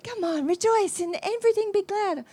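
the Lord coaxing him to rejoice; urging voice of the Lord. Retold or imitated.

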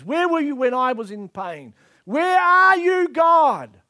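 accusing plea building to a drawn-out shout; dramatised outcry at an absent God; anguished cry to God. Acted.

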